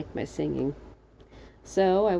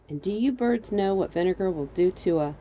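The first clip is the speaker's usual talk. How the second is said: plain reading voice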